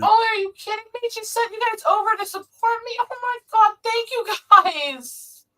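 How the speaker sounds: high-pitched, delighted disbelief at friends showing up; excited surprise. Real, not acted. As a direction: squeal out a thrilled thank you. Streamer surprised by supporters' arrival.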